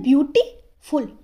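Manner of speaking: Beautiful slowly split, gap after beauty; beautiful in two separated parts; pause mid-word. Shown as a demonstration. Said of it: "दो syllables हैं".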